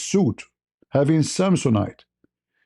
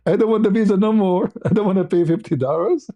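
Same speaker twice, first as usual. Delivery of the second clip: high, whining mock refusal; mock indignation at being charged; retold upset; 7 semitones higher than usual. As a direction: protest comically, laughing partway through. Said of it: "I get upset".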